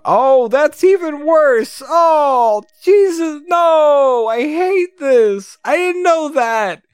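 high, wailing disbelief; slow, high-pitched horror; real horrified dismay. Real, not acted. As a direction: cry out high, appalled. Reader horrified by what he sees.

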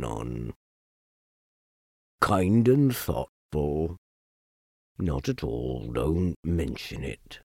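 low, quiet, glum donkey; gloomy donkey. Acted. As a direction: mope it out low and soft. Eeyore gloomy.

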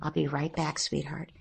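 soft, murmured reassurance; beneath my breath. Shown as a demonstration. Mother quietly promising her son she'll be right back, under her breath. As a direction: barely breathe it to the child. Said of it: "almost beneath my breath".

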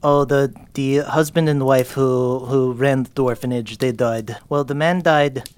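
a hint of a tired, low-voiced orphan girl; lightly voiced orphan girl. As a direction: tell it in a low, worn-down voice.

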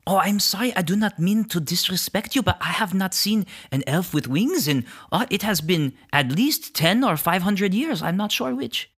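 high, lilting creature voice; apologetic tree creature. Acted. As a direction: apologise in a high, fussy lilt.

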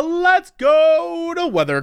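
high, slow wailing banshee voice. Real, not acted. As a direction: wail it like a banshee. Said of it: "I sound like a banshee".

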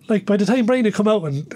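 ordinary talk

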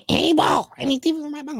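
squeaky high joke voice, lifted way up; silly high voice. Pretend. Goofing to the chat in a squeaky high voice. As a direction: pitch it way up for a goofy ask.

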